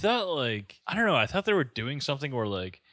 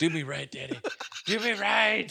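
mock seductive plea; 8 semitones higher than usual.

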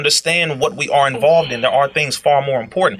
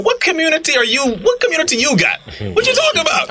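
indignant; 11 semitones higher than usual. Real, not acted. Indignant rebuttal to platforms.

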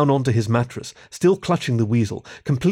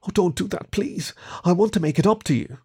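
higher, anxious little plea; pleading; 6 semitones higher than usual. A narrator's reading. Kieran begs the rat not to call guards, pleading. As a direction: beg him to let you make amends.

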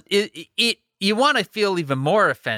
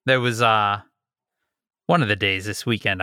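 normal talking voice

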